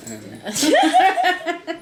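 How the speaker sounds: very high, laughing squeal. Real, not acted. Friend cracking up at the OxyClean joke. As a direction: squeak the word out through laughter. Joking chemical list.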